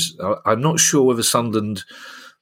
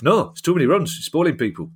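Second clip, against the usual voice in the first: Jeff Boycott impression; 8 semitones higher than usual. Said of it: "That's a good thing, Jeff".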